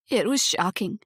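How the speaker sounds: rough, hoarse, holding back tears; hoarse voice catching. A narrator's reading. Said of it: "she said in a hoarse voice".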